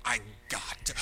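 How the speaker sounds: a hushed, eager whisper of desire; breathless whisper. Retold or imitated. Shopper caught up in wanting something, breathless whisper. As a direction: whisper it like you can't resist. Impulsive buyer wanting item.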